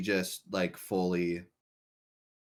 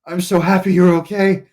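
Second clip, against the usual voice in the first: higher and much louder, bursting with joy; overjoyed; 10 semitones higher, 17 dB louder than usual. Acted. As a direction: gush with relief at your friend. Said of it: "He's like".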